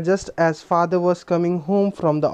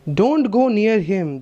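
stern warning; 5 semitones higher, much slower than usual. Acted. Inspector stops Leela running to the thief, stern warning. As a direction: stop her with a firm order.

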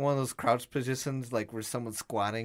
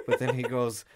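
plain reading voice

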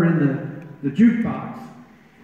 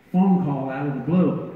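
normal talking voice

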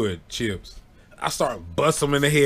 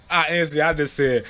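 usual speaking voice

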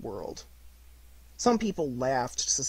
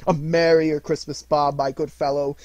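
put-on louder, jovial old man; hearty and jolly; 3 semitones higher, 9 dB louder than usual. A narrator's reading. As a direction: beam with generosity. Scrooge joyfully generous.

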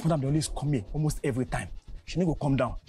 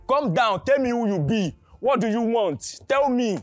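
loud, higher-pitched demands; screaming; 4 semitones higher, 4 dB louder, slower than usual. Acted. Guard interrogating the woman he detains, screaming. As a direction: shout each demand at her.

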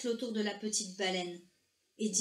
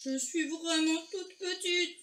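high, slow whimper in whale voice; moaning whale; 6 semitones higher than usual. A narrator's reading. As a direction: moan it out, drawn and plaintive. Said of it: "gémit la baleine".

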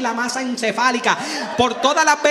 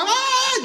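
mock wail; 13 semitones higher than usual. Mock crying scream.

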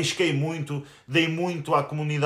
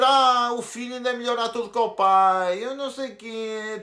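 mocking impression of rival; 7 semitones higher than usual. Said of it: "esse menino gozou a dizer".

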